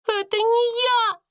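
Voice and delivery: very high, wailing denial; acted tearful squeal; tearful protest. A narrator's reading. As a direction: wail it like a crying servant girl. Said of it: "со слезами уверяла Бекки".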